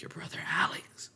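hushed whisper of mom; whispered aside. Retold or imitated. Mom whispering about the brother. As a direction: lean in and whisper the name. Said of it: "But she's like".

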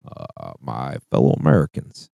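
low, soft presidential address; mock Obama speech opening; Obama impression. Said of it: "I can do Obama pretty good".

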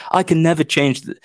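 mock whiny quote. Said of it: "People are like".

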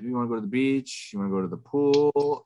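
high, singsong imitation of helpful offers; chirpy suggesting wife. Wife trying to help during a meltdown, mock-eager.

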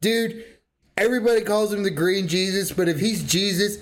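ordinary delivery

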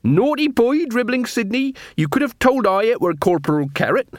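high-pitched telling-off; scolding beggar queen. Acted. Beggar queen scolds her companion in the corridor, high scolding.

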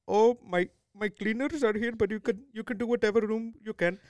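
put-on Indian-accented homeowner giving instructions; heavy Indian accent. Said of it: "he had a mega seven eleven accent".

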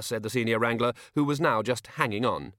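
ordinary delivery, nothing marked

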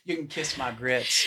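Southern drawl. Retold or imitated. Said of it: "you know what you just said?".

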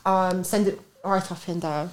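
reading the patois line softly; quiet hesitant reading. Retold or imitated. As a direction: mumble it while finding your place. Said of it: "I am at".

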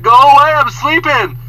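loud, high mock yell; annoyed guest. Sleeping guest telling maid to go, mock annoyed yell. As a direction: yell it back at the door, hammed-up.

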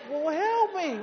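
high, loud comic plea; hammed-up yelp for rescue; retold cry for help. Jumped man calls to staring ushers, comic high cry. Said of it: "all I could do was go".

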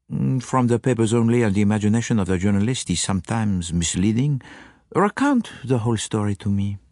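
fussy little Belgian asking his friend; French-accented Poirot. A narrator's reading. Poirot asks Japp.

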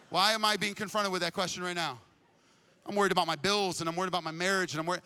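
usual speaking voice

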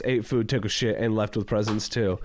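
usual speaking voice

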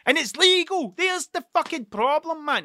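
high, loud indignant outburst; indignant rant. Real, not acted. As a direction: pitch it right up, indignant and loud. Indignant rant about painkillers.

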